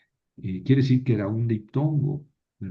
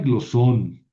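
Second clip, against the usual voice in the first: glosón with a stressed, louder -SÓN; stress on last syllable; 4 dB louder than usual. Shown as a demonstration. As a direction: hit the final syllable of glosón. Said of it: "cae en la última sílaba". (glosón con acento marcado en la última sílaba)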